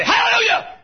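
high mock cry of joy; preacher voicing a teen's joyful outburst; joyful hallelujah shout. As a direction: shout it like a happy kid jumping up and down. Youth rejoicing, voiced by preacher.

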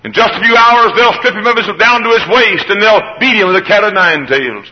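high, ringing preacher's cadence; preaching at full force. Acted. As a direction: drive each line home to the congregation.

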